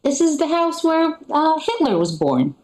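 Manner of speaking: sweetly presenting a tourist sight; parents playing tour guide. Parents showing their kid Hitler's birthplace, mock cheerful. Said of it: "my parents are like".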